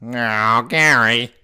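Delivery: bored neighbour moan; Squidward voice. Shown as a demonstration. Voice tutor demoing Squidward. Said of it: "talking like this".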